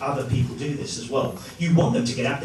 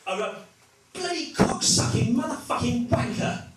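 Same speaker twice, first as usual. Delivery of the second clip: higher, hammed-up genteel cursing; posh English insult; 10 semitones higher than usual. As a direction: swear like a prim English gentleman. Road rage insults, posh.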